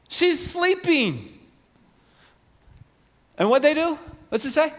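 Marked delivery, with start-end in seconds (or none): 0.1-1.3 high, slow "She's sleeping" with the voice lifting high and held long; Jesus gently insisting. Retold or imitated. Jesus correcting the mourners, high and slow. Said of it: "And he says".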